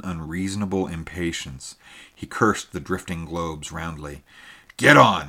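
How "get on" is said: a loud, higher barked command; furious shout. A narrator's reading. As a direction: yell at them to move. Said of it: "he cried".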